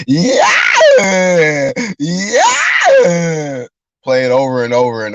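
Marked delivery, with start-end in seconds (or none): 0.1-3.7 loud wailing yeah; mock crying yell. Mocking the winner's crying on replay, a loud wail.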